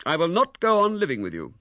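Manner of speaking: loud, deliberate, final; emphatic anger. A narrator's reading. Husband declaring the end to his wife, loud and final. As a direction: make sure she cannot mistake it. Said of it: "lest there should be any mistake".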